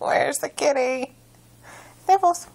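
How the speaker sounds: high, loud playful pet call; talking to a toddler. Pretend. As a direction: sing it out to find the cat. Peekaboo call to cat.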